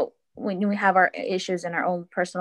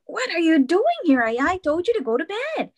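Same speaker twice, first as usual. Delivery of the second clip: put-on raised, exasperated scold at her child; irritated scolding; 8 semitones higher than usual. Mom scolding her four-year-old for getting up, annoyed. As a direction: snap at the kid who keeps getting up.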